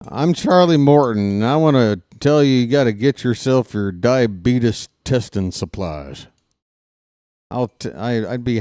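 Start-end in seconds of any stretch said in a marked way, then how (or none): 0.1-6.3 loud, fast TV pitchman; chipper 1950s ad. Pretend. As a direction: sell it like a cheesy commercial. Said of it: "if I'm watching".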